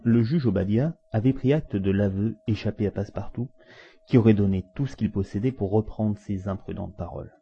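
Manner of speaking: ordinary delivery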